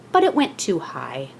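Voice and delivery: plain reading voice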